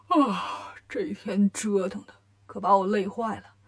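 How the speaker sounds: weary lion demon with a yawn; yawning, tired. A narrator's reading. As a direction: sigh it out sleepily, pitch sinking. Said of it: "狮子精打了个哈欠". (打着哈欠，疲惫地说)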